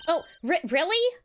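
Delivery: jumps high on "really"; surprised delight. Acted. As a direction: squeal it with glee. Reads kid line.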